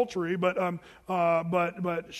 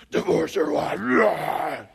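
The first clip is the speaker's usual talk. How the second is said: slow, loud, growling demon voice; monster voice; 6 dB louder, much slower than usual. Pretend. Pastor mocking a cartoon Satan, slow, loud and growling. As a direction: drag it out like a cartoon devil. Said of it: "I don't think Satan talks like this".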